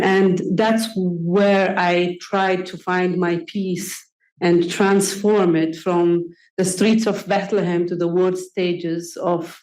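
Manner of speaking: ordinary delivery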